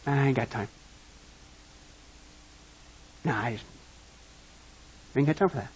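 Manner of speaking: very soft, low mock brush-off; dismissive brush-off. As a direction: shrug it off like you couldn't be bothered. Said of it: "And then we say".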